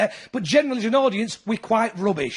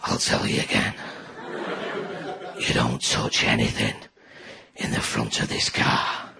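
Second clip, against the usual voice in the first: very low, slow, quiet gravel threat; dad threatening the kids in the back of the car; Lee Van Cleef menace; 6 dB quieter, slower than usual. Retold or imitated. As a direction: growl it like a spaghetti western villain. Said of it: "You just turned into Lee Van Cleef".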